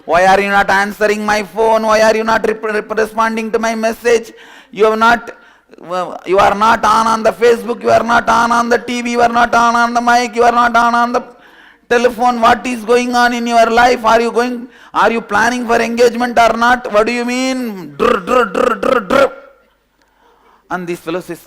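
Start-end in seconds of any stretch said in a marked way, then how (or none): 0.1-17.9 high, loud nagging rant; angry girlfriend scream. Retold or imitated. Girlfriend rants loudly at him for not answering. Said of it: "she's screaming". 18.0-19.3 mock chatter. Girlfriend's endless chatter.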